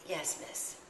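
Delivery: barely voiced, shy "yes miss"; timid whisper. A narrator's reading. Bonnet girl answers Coraline in a timid whisper. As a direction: answer under her breath.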